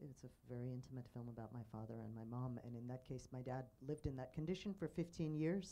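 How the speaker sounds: ordinary delivery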